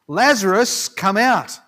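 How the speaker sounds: loud commanding call; calling out loudly. Retold or imitated. Preacher voicing Jesus calling Lazarus from the tomb, loud call. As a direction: call him out of the tomb, full voice. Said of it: "he called out in a loud voice".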